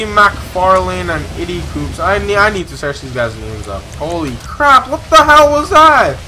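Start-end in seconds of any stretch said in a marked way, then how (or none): 4.0-6.2 higher-pitched, incredulous exclamation; astonished outburst. Real, not acted. Gamer looking back on the shootout, astonished. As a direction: let the disbelief climb as you speak.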